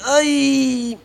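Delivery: a short, high, quiet little ay of pain. Retold or imitated. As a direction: let out a soft, high ouch. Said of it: "me quejé". (un ay de dolor agudo y bajito)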